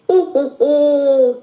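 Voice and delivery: loud high witch cackle; loud cackling laugh. Acted. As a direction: let out a big witchy cackle.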